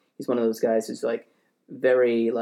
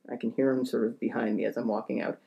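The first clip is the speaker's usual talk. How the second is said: ordinary talk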